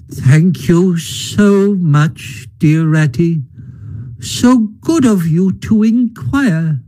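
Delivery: high, slow, weak invalid voice; feeble Toad. A narrator's reading. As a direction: sound frail and sickly, dragging each word. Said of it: "a feeble voice replied".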